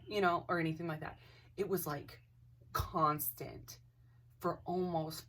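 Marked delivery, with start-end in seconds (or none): none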